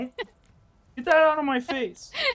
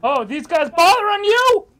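hero voice; 6 semitones higher, 10 dB louder than usual. Pretend. Acting superhero.

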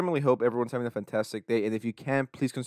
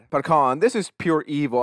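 ordinary talk

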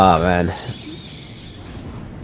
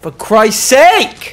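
high, slow, drawn-out cry; stretched-out exasperated yell; exasperated shout; 11 semitones higher, much slower than usual. Real, not acted. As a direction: curse the game in frustration. Cursing the lost game.

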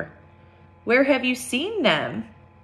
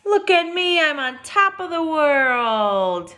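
lifted, sing-song "Look at me"; picture book read-aloud; storybook refrain; 7 semitones higher, slower than usual. A narrator's reading. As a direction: read the refrain brightly for kids.

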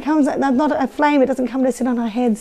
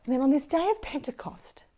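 ordinary delivery, nothing marked

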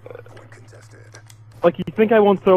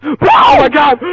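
higher-pitched sudden exclamation; startled shout; 5 semitones higher than usual. Real, not acted. Player about to charge in, excited shout. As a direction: blurt it out at full excitement.

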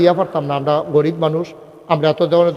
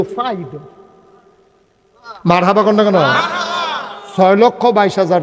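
raised, higher preacher's call to the crowd; preaching voice; 4 semitones higher than usual. Real, not acted. Preacher announcing mosque toilets to congregation, raised voice. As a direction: proclaim the plans to the gathering. Describes mosque plans loudly.

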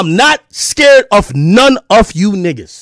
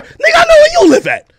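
furious shout. Real, not acted. Answering a rival who says he knows where he lives, in a furious cracking shout. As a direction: voice cracking up high in a yell.

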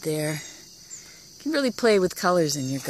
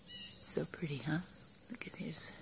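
soft, much quieter whisper; 10 dB quieter than usual. Real, not acted. Gardener showing dewy tomatoes, whispering. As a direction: hush it like a secret.

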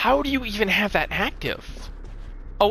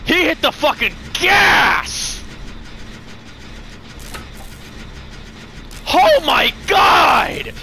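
amazed shouting; 9 semitones higher than usual. Real, not acted. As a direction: yell in real awe at the speed. Stunned by killer's sudden speed.

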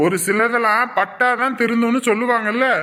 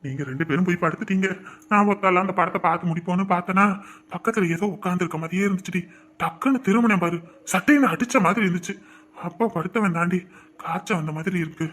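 weak feverish telling; 4 semitones lower than usual. Acted. Feverish husband telling wife his ghost scare, low and weak. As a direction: tell it shakily from the sickbed.

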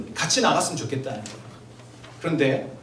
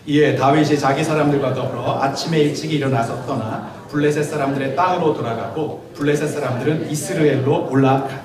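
scripture reading; 3 semitones lower, 4 dB louder than usual. Acted. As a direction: read each verse out deliberately, like from the pulpit. Reads the chapter aloud.

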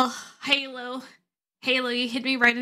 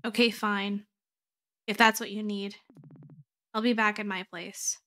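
subdued resignation; 2 semitones lower, 4 dB quieter than usual. Acted. Acted partner gives in and leaves.

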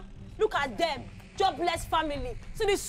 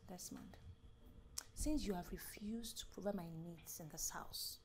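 cold whisper; 8 semitones lower, 20 dB quieter than usual. Acted. Wife refusing husband, whispering coldly.

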